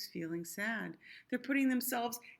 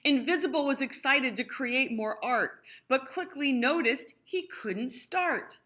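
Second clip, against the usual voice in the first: put-on lively read-aloud to children; sing-song rhyming storytelling; storybook reading; 4 semitones higher, 6 dB louder than usual. A narrator's reading. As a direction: read it like a bedtime picture book. Rhyming picture book read aloud.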